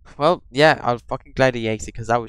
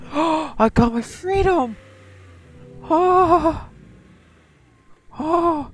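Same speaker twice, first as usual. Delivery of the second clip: high, slow, louder joyful cry; triumphant cry; 4 dB louder, much slower than usual. Real, not acted. Gamer escaping at last, soaring triumphant cry. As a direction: savour the win in a soaring voice. Reaches freedom in game.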